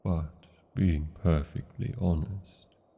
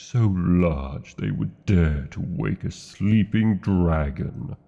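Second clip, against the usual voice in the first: loud, slow, booming threat; roaring dragon wrath; 7 dB louder, slower than usual. Acted. Dragon woken in its cave threatening the intruder, slow loud roar. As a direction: thunder it at the intruder, slowly.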